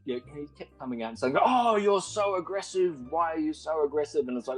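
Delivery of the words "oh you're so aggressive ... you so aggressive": mocking imitation. Mimicking the flatmate taunting her as aggressive, high whiny mock voice. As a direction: parody his taunting.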